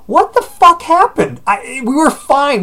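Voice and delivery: high, loud, baffled parent outburst easing off; exasperated disbelief. Retold or imitated. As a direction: act out a bewildered dad losing it. Baffled parent reacting to chaos.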